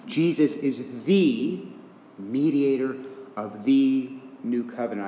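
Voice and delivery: "the" stretched long, then a pause before "mediator"; stress on THE. Real, not acted. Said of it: "I'm emphasizing that word "the".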